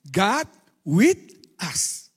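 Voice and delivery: God with us, drawn out slowly and loud; slow English phrase. Shown as a demonstration. Preacher slowly stressing God with us. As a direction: spell out God with us slowly for the congregation. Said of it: "Artinya bahasa Inggris".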